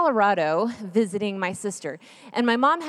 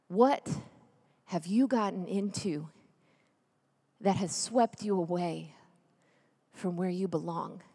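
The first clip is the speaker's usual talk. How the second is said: hushed question; 4 semitones lower, 7 dB quieter than usual. Acted. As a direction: lean in and ask it gently, barely above a murmur. Preacher asks the crowd, hushed.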